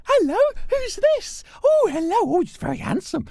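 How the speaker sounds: very high, loud cooing to a dog; doggy baby talk. Acted. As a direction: coo at the puppy like a baby. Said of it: "I kneel down and I go".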